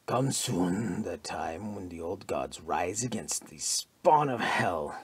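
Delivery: slow, low fanatic mutter sinking to a whisper; slow ominous mutter fading to a hiss. A narrator's reading. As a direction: brood over the words like a prophecy. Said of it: "he muttered".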